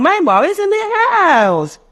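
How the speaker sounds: put-on very high, slow little child's voice; child's reply. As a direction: answer like a small kid. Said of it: "child's answer".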